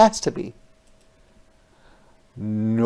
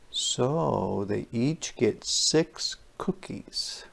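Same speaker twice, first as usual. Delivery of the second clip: whisper; 8 dB quieter, slower than usual. Acted. Aside to young listeners.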